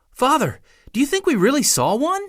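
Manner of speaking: put-on high, hopeful child's question; a young boy pleading for an answer; eager boy asking. A narrator's reading. Arthur asking his father.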